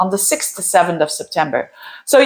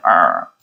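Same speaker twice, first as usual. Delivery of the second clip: very low drawn-out "errr"; low growl imitating annoyance at a boss; frustrated "er" noise. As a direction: drop deep for an exasperated growl. Repeats noise for overbearing boss.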